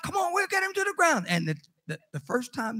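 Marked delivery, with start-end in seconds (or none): 0.0-1.2 put-on high, fast kid rallying the others; mock children's battle cry; eager kids' voices. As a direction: rush it out high like a scheming child. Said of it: "they would try to wrestle me".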